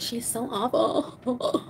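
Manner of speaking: very slow, stretched words; drawn-out tease. Real, not acted. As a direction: drag every word out mockingly. Teasing about a viewer's comment.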